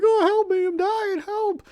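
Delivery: very high falsetto cry for help, hammed-up; mock dying woman. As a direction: squeak it out like a parody damsel. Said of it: "she's like".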